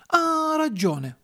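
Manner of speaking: high, drawn-out "ah" of agreement; slow, high-pitched concession; dawning realisation. Acted. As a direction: stretch it out high as it sinks in. Geppetto realises the book is missing.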